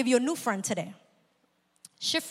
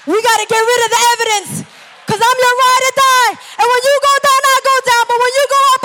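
high, loud, manic ride-or-die friend; crazy sister; 9 semitones higher, 10 dB louder, faster than usual. Acted. Crazy sister vowing loyalty to her friend, loud and high. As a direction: play the wild loyal sister at full cry. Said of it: "That's the crazy sister that says".

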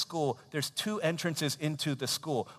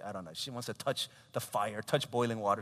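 ordinary talk

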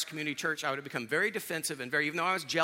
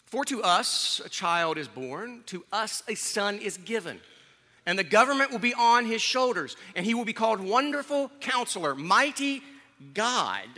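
raised, emphatic preacher's Bible reading; scripture reading; 6 semitones higher, 6 dB louder than usual. Real, not acted. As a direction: proclaim the verse to the congregation, building higher and louder. Reads scripture aloud to congregation.

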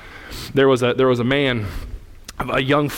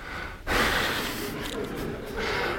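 long tired exhale; weary sigh; 8 dB quieter than usual. Acted. A pastor after the punchline, weary sigh.

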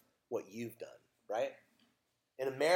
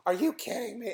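high-pitched indignant child imitation; mock freak-out; 7 semitones higher than usual. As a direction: protest like an outraged kid. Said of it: "she was freaking out".

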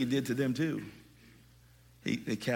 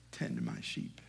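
much lower and softer, gently; tender low command; 14 semitones lower, 12 dB quieter, slower than usual. Acted. Jesus to Peter, a tender, hushed command. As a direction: lay the charge on him tenderly.

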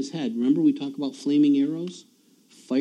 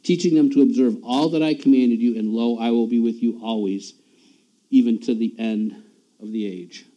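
louder scripture reading; 5 dB louder than usual. Acted. Reads the Great Commission aloud.

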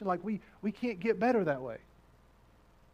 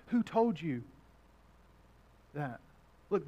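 normal talking voice

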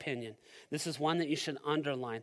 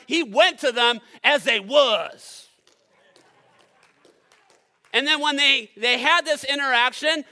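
loud, driving preacher's voice; preaching at full force; 6 semitones higher, 12 dB louder than usual. Acted. Pastor preaching loudly to his church, full force.

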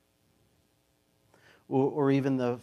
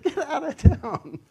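1 wife's mock dismissal; 14 semitones higher, 8 dB louder, slower than usual. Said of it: "She's like".

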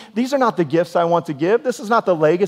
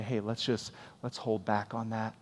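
much softer, kind coaxing voice; gentle Holy Spirit; 6 semitones lower, 10 dB quieter than usual. Retold or imitated. The Spirit gently nudging a kind-hearted believer, soft and tender. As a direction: ease them off it tenderly. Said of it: "if you have a kind, gentle heart".

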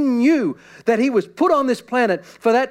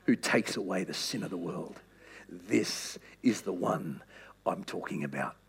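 hushed reverence; 11 semitones lower, 8 dB quieter than usual. Acted. John pointing to Jesus, low hushed reverence. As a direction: whisper the wonder of it.

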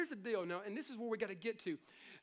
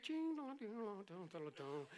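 ice cream truck jingle; 7 dB quieter than usual. Retold or imitated. Pastor badly imitates the neighborhood ice cream truck jingle. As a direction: hum the chime like a toy truck. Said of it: "pretty bad imitation of an ice cream truck".

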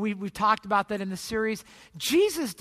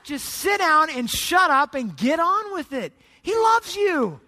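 building to loud, raised-pitch urging; emphatic exhortation; 4 semitones higher, 4 dB louder than usual. Acted. [name] urging doubters to accept God's love, loud exhortation. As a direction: preach it forcefully, peaking at the end.

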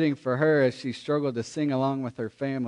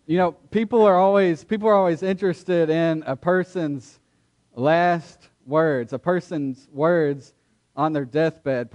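louder preaching with full conviction; 4 dB louder than usual. Acted. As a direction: preach it out to the whole room. Pastor preaching to congregation.

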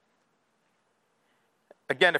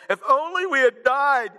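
higher, whiny complaint; mock whining grumblers; whining Israelites; 7 semitones higher than usual. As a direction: moan like a spoiled crowd. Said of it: "The Israelites said to them".

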